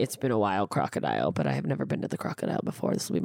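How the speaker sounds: low comic aside to the venue; low mock voice. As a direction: sink into a low, soft, joking voice. Repeated greeting to Crocodile venue.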